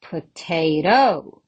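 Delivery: potato with the final TO punched; potaTO, leaning on the last syllable; stress on last syllable. Shown as a demonstration. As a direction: wrongly stress the end of potato. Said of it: "Definitely the second one".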